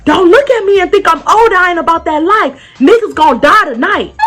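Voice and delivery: high, heated hollered warning; threatening older man. Retold or imitated. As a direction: imitate an angry father warning everyone. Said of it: "the older man said".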